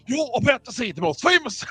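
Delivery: high-pitched mock Australian accent; Australian Hagrid. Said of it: "I was an Australian Hagrid".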